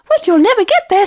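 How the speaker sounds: high, pressing hare voice; high-pitched, impatient little animal; urging hare. Acted. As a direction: scold the straggler. Hare scolding the lagging lion.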